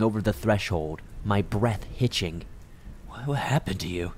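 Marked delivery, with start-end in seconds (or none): 3.1-4.1 hesitant, hushed question; softly desperate, halting question; mild desperation. A narrator's reading. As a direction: ask with a touch of desperation, stumbling on the first word. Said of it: "desperation spilling from my lips".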